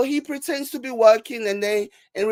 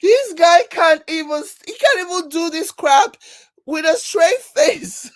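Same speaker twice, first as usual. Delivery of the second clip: loud and high, on the edge of laughter; high, amused exclamation; hysterical disbelief; 7 semitones higher, 6 dB louder than usual. Real, not acted. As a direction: exclaim it while cracking up. Incredulous amid a laughing fit.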